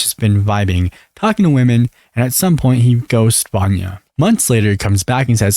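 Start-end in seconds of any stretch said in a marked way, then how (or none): none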